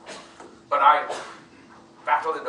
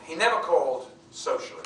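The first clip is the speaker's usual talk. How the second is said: ordinary talk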